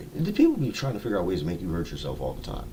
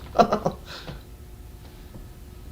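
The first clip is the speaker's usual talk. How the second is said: high, voiceless acted chuckle; mock laughter; 13 semitones higher, 6 dB quieter than usual. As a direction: laugh off the sleeping gas. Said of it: "I'm just laughing".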